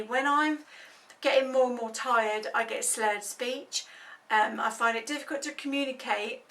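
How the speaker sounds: ordinary delivery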